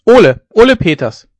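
loud, high call for Ole; shouting a name. A narrator's reading. Marsh youths call for Ole with a high, loud shout. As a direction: shout for your champion across the field. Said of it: "schrie die Marschjugend".

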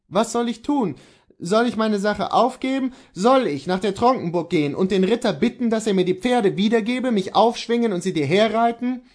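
high, urgent questioning; Kohlhaas the husband. Acted.

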